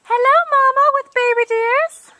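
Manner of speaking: loud cooing greeting to the deer; high calling out. Real, not acted. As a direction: call out to the deer family from afar.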